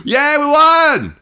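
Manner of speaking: high, loud, slow victory cheer. Pretend. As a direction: shout the win with glee.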